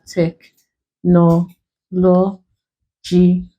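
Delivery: "technologically" in separate syllables, a pause after each; broken into syllables. Shown as a demonstration. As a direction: say "technologically" slowly, chopped syllable by syllable. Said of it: "if you break this one".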